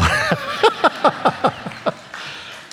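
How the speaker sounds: high, voiceless laugh of relief; happy breathy laughter; joyful laugh. Real, not acted. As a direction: laugh out the joy. Rejoicing laughter after praise.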